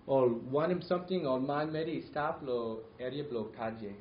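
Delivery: Papua New Guinea Pidgin spoken; speaking Tok Pisin; Pidgin English. Shown as a demonstration. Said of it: "This, this, this is what it sounds like".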